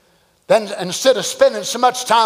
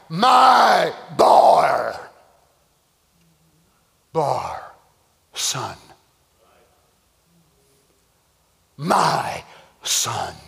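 tender father; 9 semitones lower, much slower than usual. Acted. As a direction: answer gently, like a father comforting his boy. Abraham answers his son.

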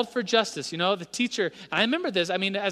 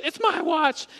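put-on insistent kid; 11 semitones higher, 4 dB louder, slower than usual.

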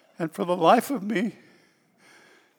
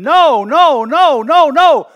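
high, loud screamed no; screaming no; 9 semitones higher, 14 dB louder, much slower than usual. Retold or imitated. Pastor reliving his inner scream against going. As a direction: scream the refusal. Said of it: "I was screaming".

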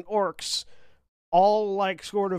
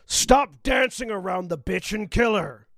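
loud orc yelling orders, pitched up; orcish voice; 4 semitones higher, 4 dB louder than usual. Acted. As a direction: bellow it like an orc commanding the gang. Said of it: "a deep barreling orcish voice going".

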